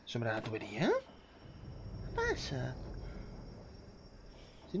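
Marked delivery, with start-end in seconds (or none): 0.9-2.7 very slow high squeak; squeaky falsetto. Pretend. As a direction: drag it out in a silly squeak. Incredulidad burlona por la sombra. (falsete muy lento y agudo)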